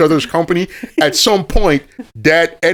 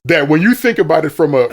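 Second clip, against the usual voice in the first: ordinary talk, nothing marked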